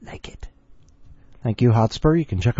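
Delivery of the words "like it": whispering. Real, not acted. Whispered reaction.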